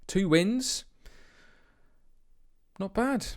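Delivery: excited. Real, not acted. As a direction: crow about it happily. Gloating about the league table.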